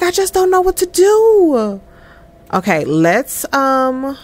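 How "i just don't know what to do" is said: voicing Amina. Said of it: "She's like".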